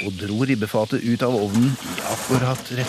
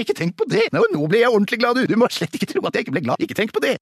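high-pitched, rushed protesting; overeager reassurance; 6 semitones higher than usual. Acted. Father insisting to his family he loves socks, overeager. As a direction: gush reassurances, climbing higher and more insistent.

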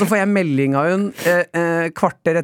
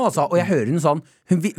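normal talking voice

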